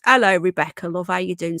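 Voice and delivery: usual speaking voice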